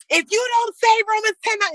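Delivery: high, mock-shouted demand; hammed-up high pushy preacher. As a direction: lampoon an overbearing preacher yelling scripture. Mocks pushy preacher on set.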